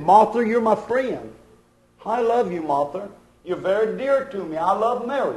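soft, tender reassurance; lightly voiced Jesus speaking softly to a friend. Jesus tells Martha his love.

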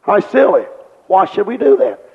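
normal talking voice

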